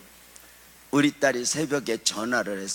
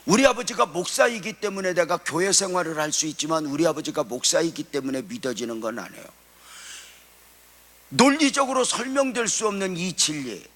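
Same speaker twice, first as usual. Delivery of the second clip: raised, ringing sermon delivery; fervent preaching; 7 semitones higher, 3 dB louder than usual. Acted. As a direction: preach it out to the whole congregation. Preaching at full force.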